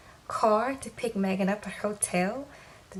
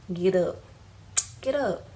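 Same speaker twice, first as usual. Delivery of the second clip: mock rude order. [name] ordering Sarah up rudely.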